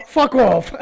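loud laughing high shout; defiant shout. Retold or imitated. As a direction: fling it out with glee. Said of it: "mä kirjoitin niille vaan". (huvittunut huuto)

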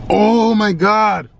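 slow drawn-out pained groan. Real, not acted. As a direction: stretch it out in real pain. Wincing from spicy slushie.

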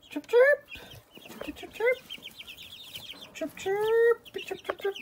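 very high chirping calls; chick chirps. Shown as a demonstration. Owner chirping high to get chicks to answer. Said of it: "get one to do this".

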